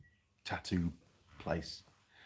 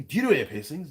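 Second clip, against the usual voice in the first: high, bold retold question; brazen; 7 semitones higher, 3 dB louder than usual. Customer at the pharmacy counter asking about piercings, brazen. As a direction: put the question brazenly, pitched up. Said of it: "quite brazenly".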